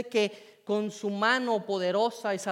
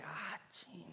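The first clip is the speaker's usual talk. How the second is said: breathy whispered swear under the breath; whispered curse; 19 dB quieter than usual. Retold or imitated. Student dismayed by the lifeguard test, whispered curse.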